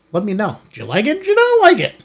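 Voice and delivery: ordinary talk, nothing marked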